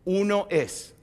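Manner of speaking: slow solemn recital. Shown as a demonstration. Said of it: "eh, eh, o-, o".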